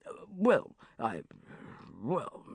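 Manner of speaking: halting, spluttering non-answer; flustered huffing. A narrator's reading. Banker cornered by Sharon's question, huffing. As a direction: stall and huff, lost for words. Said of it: "huffed Cosmo".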